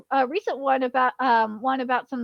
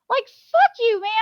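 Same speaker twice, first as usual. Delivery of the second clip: high, loud angry outburst; angry shout; 9 semitones higher, 6 dB louder than usual. Real, not acted. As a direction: shout your frustration. Ranting at localizers.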